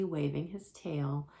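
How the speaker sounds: ordinary delivery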